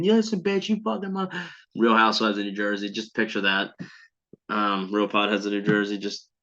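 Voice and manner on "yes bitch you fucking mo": high-pitched, mock confrontational yell; put-on screechy outburst at much higher pitch; screaming talk-show guest. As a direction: imitate a trashy TV guest going off. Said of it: "people just in Jerry's faces pointing".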